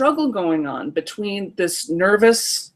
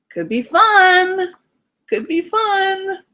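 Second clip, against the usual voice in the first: put-on much higher, very slow sing-song kid voice; coaxing little kid; 11 semitones higher, much slower than usual. Inner child coaxing the parent, high and drawn-out. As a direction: tempt the parent like a small child. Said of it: "My kid says".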